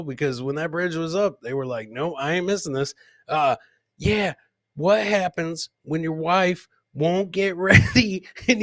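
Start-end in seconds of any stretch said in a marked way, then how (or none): none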